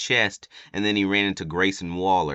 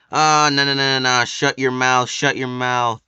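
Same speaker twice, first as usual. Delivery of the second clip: loud, higher mock shouting of no and shut your mouth; mock yell; 6 semitones higher, 7 dB louder than usual. Roman Reigns shouting down Jey in the ring, hammed-up yell. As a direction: bellow it like a wrestler cutting off a rival. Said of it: "he was like".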